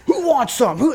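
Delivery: high, pushed imitation of a challenger; mock-belligerent dare. Skinhead daring the whole group to fight, mock-belligerent.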